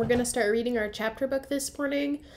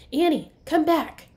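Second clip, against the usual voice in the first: higher, louder plea; calling back; 7 semitones higher, 8 dB louder than usual. Acted. Jack calling his sister off the ladder, worried call.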